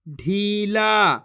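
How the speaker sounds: ढीला very slow, both vowels held; syllables drawn out. Shown as a demonstration. Teacher reads the word, ढीला drawn out. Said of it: "जिस तरीके से मैं खींच खींच के पढ़ रहा हूँ".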